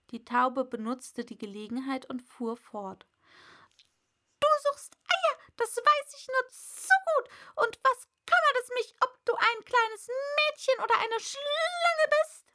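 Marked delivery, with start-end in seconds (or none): 4.4-12.5 high, loud bird voice, ranting; complaining pigeon. A narrator's reading. Pigeon ranting to Alice, high and loud. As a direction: rattle off the grievances, shrill bird.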